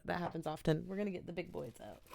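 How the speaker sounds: ordinary talk, nothing marked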